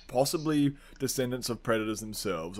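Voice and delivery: ordinary delivery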